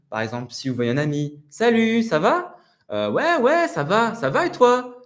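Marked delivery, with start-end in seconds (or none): 1.5-5.0 Salut, ça va? high and loud, Ouais ouais ça va ça va et toi stretched at the ends; lengthened phrase ends. Shown as a demonstration. Teacher acting a friend's greeting to show stretched phrase endings. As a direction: play a cheery friend, drawing out each phrase end. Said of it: "vous entendez que".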